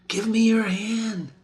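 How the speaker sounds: breathy sleepwalker's whisper. Acted. Lady Macbeth in her sleep, whispering to an unseen husband. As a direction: hush it like a secret.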